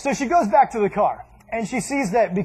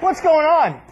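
gawking neighbor; 7 semitones higher, 5 dB louder, slower than usual. Retold or imitated. Neighbor gawks at mom.

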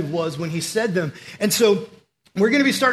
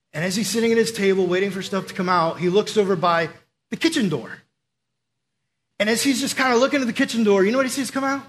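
ordinary talk, nothing marked

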